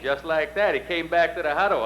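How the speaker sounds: usual speaking voice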